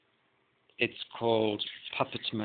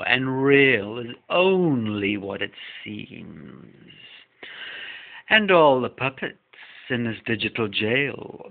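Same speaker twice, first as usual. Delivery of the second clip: slow, louder, deliberate lyric reading; poem recitation; 6 dB louder, slower than usual. Acted. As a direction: recite each line slowly and with weight, like a spoken-word piece. Reads [name] lyrics.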